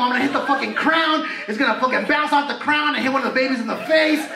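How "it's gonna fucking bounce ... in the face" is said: normal talking voice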